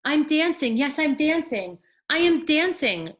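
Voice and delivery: high, loud and overjoyed; joyful cry. Acted. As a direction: burst out in delight at dancing. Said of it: "Gerald cried".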